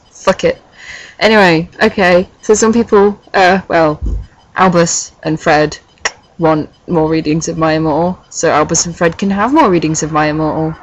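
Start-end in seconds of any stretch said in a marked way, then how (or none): none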